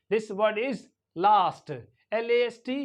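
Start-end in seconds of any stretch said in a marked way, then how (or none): 1.2-1.7 LAST as 'lahst', long open vowel; British long A. Shown as a demonstration. Teacher modelling British English, long-ah LAST. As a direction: say last with the A of father. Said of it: "it is longer".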